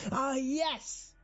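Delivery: very high, gleeful character exclamation; delighted cry. A narrator's reading. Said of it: "he cried".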